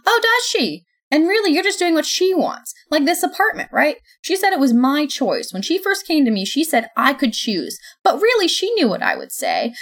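high, loud sarcastic fury; sarcastic anger. Acted. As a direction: spit it back, bitterly sarcastic. Sophie's sarcastic furious reply.

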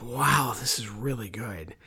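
low, soft retold wonder; quietly impressed. Host retelling first listen to Sue, quietly impressed. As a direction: drop low and soft, understated awe. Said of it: "I told Sue, I'm like".

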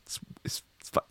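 hushed aside, whispered. Pretend. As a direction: drop to a whisper. Whispered aside in cell call.

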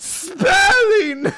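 high, shouted punchline; gleeful shouted pun; triumphant punchline. Acted. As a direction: crow the answer proudly.

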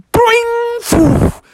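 high falsetto boing; cartoon catapult twang; springy boing. A narrator's reading. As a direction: imitate a tree springing back. Tree springs back.

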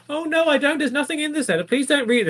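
very high comic pleading; panicked son. Retold or imitated. Son begging father not to read letter, comic panic. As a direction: plead in a squeaky sitcom panic. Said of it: "feels like a sitcom".

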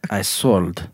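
halting mock-Russian confession; comic Russian convict; Russian accent. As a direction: put on a Russian accent, pausing between phrases. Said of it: "I don't know why he's Russian".

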